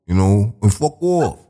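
demonstrating New York slang and accent. Shown as a demonstration. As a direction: put on a New York accent, tossing out each "yo". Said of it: "if you had a New York accent".

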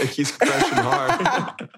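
slow teasing words through laughter; laughing tease. Real, not acted.

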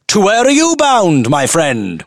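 high-pitched posh sing-song with great relish; sing-song posh accent. Acted. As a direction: relish every posh, lilting word. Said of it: "loudly inquiring".

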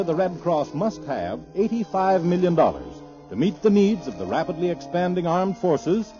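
ordinary delivery

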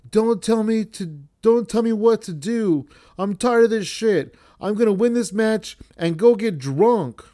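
a hammed-up wrestler telling off the ref; imitated angry yell. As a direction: play a fed-up heel snapping back. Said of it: "Tony Deppen yells at Scarlett".

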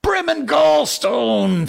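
high, loud, slow roar of an oath; thundering. Acted. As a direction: thunder the curse out. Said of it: "thundered Hook".

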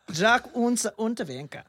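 loud, high mock-German accent; best German accent. Shown as a demonstration. Host repeating the killer's name for laughs, mock German accent. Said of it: "do it in my best accent".

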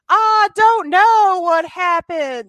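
slow, high, loud mock Southern belle; terrible acting. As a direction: overact it like a bad actress in a Southern accent. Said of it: "her terrible Southern accent".

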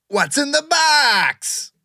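very high, slow, drawn-out question; dramatic game-show question. Pretend. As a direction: stretch it out like a spooky host. Announcing the show's game.

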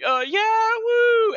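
put-on very high, loud drunken whoop; drunk cheering. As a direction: cheer wildly like a drunk fan. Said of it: "And I'm just like".

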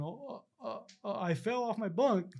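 high, stammering mock-nervous excuse; imitating scared. Storyteller imitating the scared cellmate's cover story, mock-nervous. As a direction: stall timidly, then drag out the lame lie. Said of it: "He's all scared".

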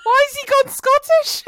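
high, loud, laughing disbelief. Real, not acted. Co-host reacting to the failed accent, helpless laughter. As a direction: shriek the question through laughter.

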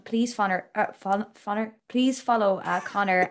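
usual speaking voice

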